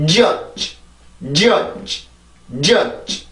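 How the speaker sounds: judge with a strong first j and a soft, released final dge; soft final consonant. Shown as a demonstration. Teacher contrasting both sounds, judge ending softly. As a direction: hit the start of judge, let the end go gently on leftover air. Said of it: "아주 부드럽고 천천히 발음을 해주면".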